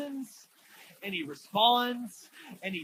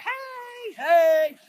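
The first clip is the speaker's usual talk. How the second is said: calling out; 12 semitones higher, 10 dB louder than usual. Retold or imitated. Sick man calling out to Jesus.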